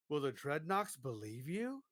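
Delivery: normal talking voice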